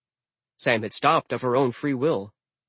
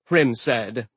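plain reading voice

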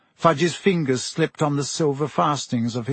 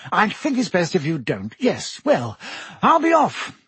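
brisk Minister voice; 6 semitones higher than usual. A narrator's reading. Fudge voiced by narrator.

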